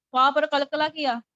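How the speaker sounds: usual speaking voice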